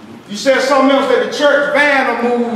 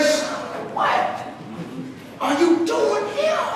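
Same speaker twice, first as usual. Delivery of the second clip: tearful mother; 7 semitones higher, 9 dB quieter, slower than usual. Retold or imitated. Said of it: "She came in crying".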